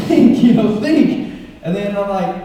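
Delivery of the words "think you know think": high, loud self-urging; urging himself. Retold or imitated. Urging himself at the light, high loud mock urgency. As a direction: push yourself to think fast.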